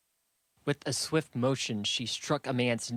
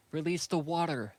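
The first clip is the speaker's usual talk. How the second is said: higher-pitched call, little added volume; cried command; 5 semitones higher than usual. A narrator's reading. Saku signals villagers at the water tower, calling out high. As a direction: call out the order in a contained high voice. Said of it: "Saku cried".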